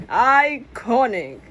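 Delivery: slow, drawn-out sarcastic drawl; sarcastic verdict. Acted. Press mocking the new girl, sarcastically drawn out. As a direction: savour the mockery.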